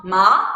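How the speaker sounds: rising "ma"; questioning upward "ma"; second tone. Shown as a demonstration. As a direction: start "ma" low and glide it up. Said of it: "three tones sound in a row".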